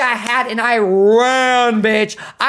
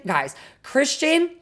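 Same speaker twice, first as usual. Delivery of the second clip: normal talking voice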